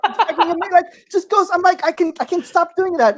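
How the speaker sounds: high-pitched, hammed-up raving; gushing excitement. Comic raving to the crowd about his discovery, gushing.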